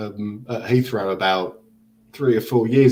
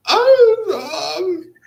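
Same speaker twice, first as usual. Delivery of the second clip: tiny high falsetto, meek and halting; mock timid squeak; much slower than usual. Speaker acting out meekly paying at a silent pub, timid squeak. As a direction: play it comically cowed, very high and slow.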